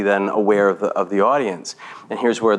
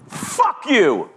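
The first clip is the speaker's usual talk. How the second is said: high, falling mock jab; demonstration insult; 11 semitones higher than usual. Shown as a demonstration. Teacher showing a class how to insult someone, mock insult. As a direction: throw it out high as a playful example. Example of something said to Riley.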